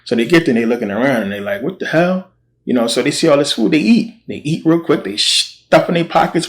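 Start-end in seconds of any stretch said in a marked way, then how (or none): 1.6-2.3 much higher incredulous what the hell; high, startled exclamation; mock surprise. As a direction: play the shock of finding the camp empty. Lepers finding empty camp.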